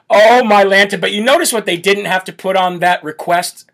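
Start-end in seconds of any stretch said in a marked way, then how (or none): none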